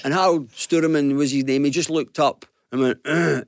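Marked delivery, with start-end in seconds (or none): 3.1-3.4 short throaty grunt; imitated grunt. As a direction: grunt in place of a greeting. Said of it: "He grunted".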